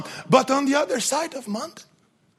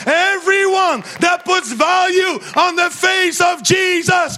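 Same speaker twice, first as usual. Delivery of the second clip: preaching at full force; 6 semitones higher than usual. Acted. Preacher urging the congregation to run after Jesus, at full force. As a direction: rouse the crowd to their feet.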